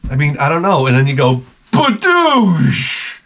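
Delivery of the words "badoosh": high, punchy mock sound effect; pop noise. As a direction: shout it like a cork bursting out. Cork popping out.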